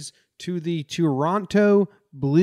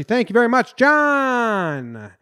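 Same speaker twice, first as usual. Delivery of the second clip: high, loud, drawn-out thank you; hammed-up thanks; 9 semitones higher, 6 dB louder, slower than usual. Host gushing loud thanks to the new sponsor. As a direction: belt out the thanks like a game show host.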